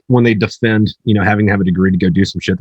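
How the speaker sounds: usual speaking voice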